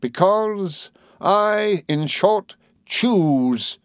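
high, drawn-out declamation; defiant burst. A narrator's reading. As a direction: burst out slowly and grandly in defiance. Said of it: "replied Mister Micawber with a burst".

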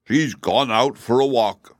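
slow, loud gruff horse voice; a lightly voiced gruff old horse; gruffly. A narrator's reading. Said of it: "said Jim gruffly".

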